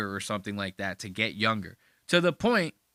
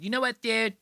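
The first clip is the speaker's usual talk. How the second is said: higher, playful echo of the title; put-on podcast title; 9 semitones higher than usual. Host echoes Robert Kelly's podcast title, playfully put-on.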